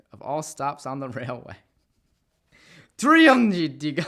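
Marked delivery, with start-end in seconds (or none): 3.0-4.0 loud high delighted shout of the name; gleeful yelled name; excited exclamation. Real, not acted. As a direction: belt out the funny name with glee. Excited repeat of odd name.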